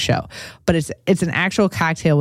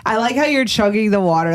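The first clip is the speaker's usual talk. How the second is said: usual speaking voice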